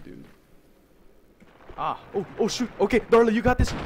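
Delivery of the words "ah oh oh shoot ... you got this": loud, high, panicky cheer; high, loud and frantic; urgent encouragement. Real, not acted. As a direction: shout them on through a near miss. Urgent cheering on during near fall.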